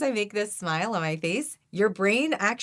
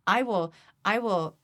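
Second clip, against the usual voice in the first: normal talking voice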